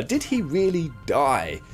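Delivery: usual speaking voice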